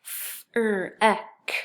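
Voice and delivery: "F-er-ek" in drawn-out separate sounds; tapping out sounds. Shown as a demonstration. Teacher tapping it out, sound by sound. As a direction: hold the f, then er, then ek. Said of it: "we're gonna tap it out".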